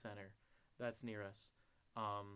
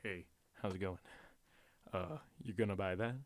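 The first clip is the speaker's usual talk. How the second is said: low, put-on man's voice; deep-voiced store clerk; 6 semitones lower than usual. Clerk at the register asking a customer, low put-on voice. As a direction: drop your voice into an imposing clerk. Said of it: "it's like fucking James Earl Jones".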